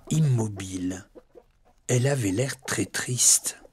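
hushed whisper for the sad hen. A narrator's reading. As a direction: drop to a breathy whisper.

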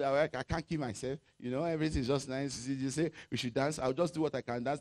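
mocking lazy pastors. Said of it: "That's how these pastors used to talk".

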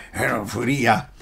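normal talking voice

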